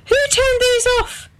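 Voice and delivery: high, indignant call from the kitchen; a wife demanding who did it, pitched high; high accusing call. A narrator's reading. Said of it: "she called".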